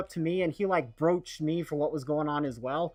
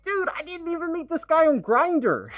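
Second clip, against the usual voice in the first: much higher, exasperated "dude" of disbelief; incredulous disbelief; 10 semitones higher than usual. Real, not acted. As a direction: scoff at the absurd accusation, pitch way up. Exasperated retort to homophobic supervisor.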